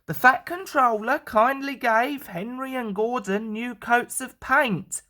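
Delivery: usual speaking voice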